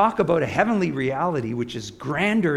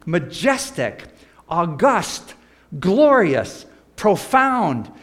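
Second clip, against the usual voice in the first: higher and louder with each word; rising preacher's crescendo; building superlatives; 4 semitones higher, 5 dB louder, much slower than usual. Acted. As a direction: pile on the praise, swelling up. Piles up superlatives for heaven.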